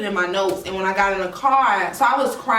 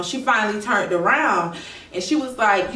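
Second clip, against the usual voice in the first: ordinary talk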